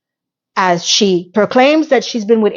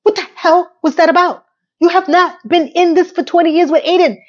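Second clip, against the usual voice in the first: high, exasperated disbelief; ranting in disbelief at the screen; incredulous rant; 7 semitones higher than usual. Real, not acted. Ranting at the show's line.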